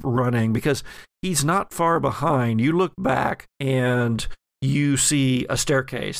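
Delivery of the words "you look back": ordinary talk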